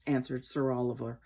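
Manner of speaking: ordinary delivery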